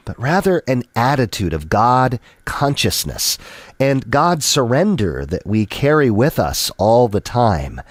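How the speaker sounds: ordinary talk, nothing marked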